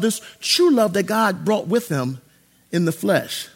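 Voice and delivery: normal talking voice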